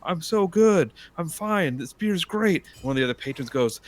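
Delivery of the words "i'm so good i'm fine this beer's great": put-on higher, slower cocky drunk voice; drunk boast. Joke's drunk patron insisting he's fine, higher voice. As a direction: brag like a tipsy patron.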